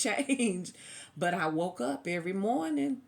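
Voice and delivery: usual speaking voice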